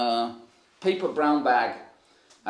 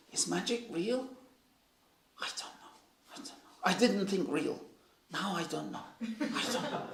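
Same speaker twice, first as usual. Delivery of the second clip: soft imitation of pondering aloud; hushed, halting mock deliberation; puzzled musing; 3 semitones higher, 9 dB quieter than usual. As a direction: wonder to yourself, nearly whispering in places. Father's musing acted out.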